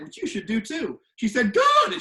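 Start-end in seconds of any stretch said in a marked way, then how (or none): 1.5-1.9 high, loud "Good!"; teacher praising the class; loud praise. Retold or imitated. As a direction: snap out the praise.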